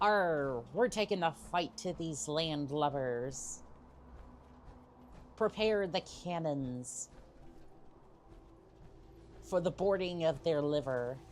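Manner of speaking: lightly hammed-up pirate accent, barely off his own voice; half-hearted cartoon pirate accent, playing to chat; poor pirate accent. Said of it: "that was probably a poor, uh, pirate accent".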